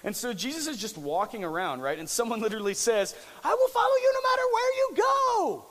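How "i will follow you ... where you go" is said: high, loud devoted yell; shouted fan devotion. Retold or imitated. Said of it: "They just yell that out".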